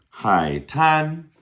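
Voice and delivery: "Hai tan" loud and deliberate, high level "tan"; model pronunciation. Shown as a demonstration. Teacher modeling beach word for learners, clear high "Hai tan". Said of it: "Repeat after me".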